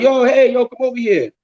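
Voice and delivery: high, sustained imitated hail; retold calling over. Group of guys calling him over on the beach, imitated high hail. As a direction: mimic guys hailing someone across a beach.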